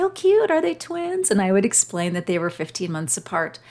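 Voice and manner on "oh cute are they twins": high, sing-song "Oh, cute" from a passer-by; cooing stranger. Retold or imitated. Mother imitating strangers cooing over her stroller, much higher. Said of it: "people would say".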